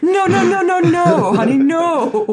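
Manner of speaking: high laughing no no honey no; reacting to the official pronunciation guide, laughing no; mock exasperation. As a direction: scold it like a hopeless friend, laughing. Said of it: "I was like".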